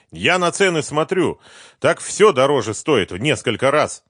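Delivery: high, loud angry reproach; scolding. Acted. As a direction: chew him out. Boss scolding his partner.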